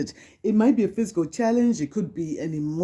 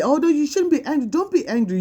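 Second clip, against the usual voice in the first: high-pitched, hurried mock scolding; high, quick don't-be-like-that voice; dismissive scolder; 8 semitones higher than usual. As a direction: imitate someone brushing off feelings in a high voice. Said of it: "trying to invalidate your feelings and say".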